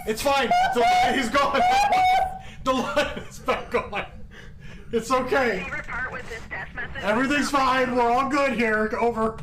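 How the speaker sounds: nervous laughing reassurance. Real, not acted. Mock radio reassurance amid fear.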